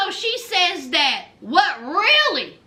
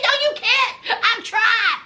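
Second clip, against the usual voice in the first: higher, defeated imitation; mock admission; 5 semitones higher than usual. Host imitating Ty admitting defeat, high mock voice. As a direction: squeak it out high. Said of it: "She said".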